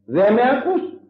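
calling out. Acted. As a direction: call out as if unheard. Acted call of the unheard.

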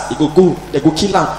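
ordinary delivery

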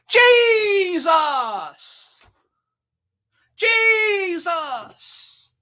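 high, loud cry of a man calling out; desperate yell. Acted. Blind beggar calls out to Jesus, shouting high and loud. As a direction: yell his name as loud as you can. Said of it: "And he yells out".

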